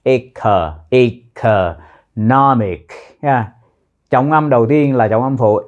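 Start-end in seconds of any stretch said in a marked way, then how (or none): 0.9-2.9 Economic slowly, stress on the "nom"; teacher drilling Economic syllable by syllable; stress on third syllable. Shown as a demonstration. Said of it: "nhấn vào âm tiết thứ ba".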